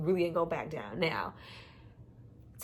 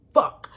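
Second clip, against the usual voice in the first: fuck hit hard, high and loud; 11 dB louder than usual. Shown as a demonstration. Viewer stressing the hard word, loud punch. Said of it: "it was a hard".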